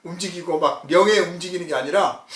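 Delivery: ordinary talk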